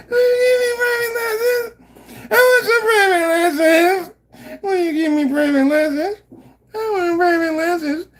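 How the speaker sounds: put-on high, squeaky begging kid voice; Butters voice. Mocking a sneaky fan by asking the teacher in a squeaky voice. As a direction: plead for lessons like a timid cartoon boy. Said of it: "There's always a Butters that'll sneak up like".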